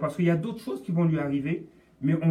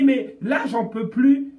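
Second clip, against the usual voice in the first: plus haut et plus fort, à bout; lâche-le comme quelqu'un au bout du rouleau; cri d'épuisement; 7 semitones higher, 6 dB louder, slower than usual. Retold or imitated. Cri de détresse cité. (cri d'épuisement)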